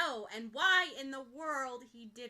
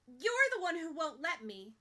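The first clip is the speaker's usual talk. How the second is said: angry accusation; 5 semitones higher, 6 dB louder than usual. Acted. Ochako keeps arguing.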